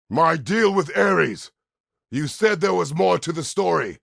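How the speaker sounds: high, booming demand; angry shout. Acted. Demanding the full story of the deal with Ares, angry shout. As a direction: roar it, insisting on an answer.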